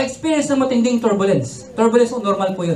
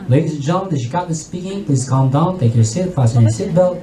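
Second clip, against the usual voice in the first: much lower, composed pilot announcement; calm captain; 9 semitones lower than usual. Retold or imitated. Captain calming panicking passengers, low composed voice. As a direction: reassure the passengers like a steady captain. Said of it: "Salita yung captain".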